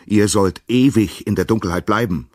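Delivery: angry god calling down from the sky. A narrator's reading. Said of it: "rief er ihnen von oben zu".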